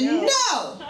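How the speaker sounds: high, sharp startled no; a quick mock-shocked refusal. Stopping the guy in the parking lot, high startled no. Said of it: "I looked at him and I said".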